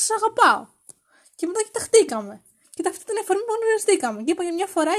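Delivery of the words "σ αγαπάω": high, loud declaration; mock shout. Explaining what was shouted at a stranger, mock yell. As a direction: blurt it out, pitched well up. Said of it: "Του φώναξα".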